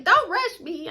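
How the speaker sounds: high-pitched imitation retort; mock irritated aunt. Imagined aunt refusing to hurry, mock irritated. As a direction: snap back as a put-upon relative.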